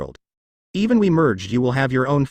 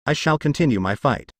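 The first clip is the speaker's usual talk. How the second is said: ordinary delivery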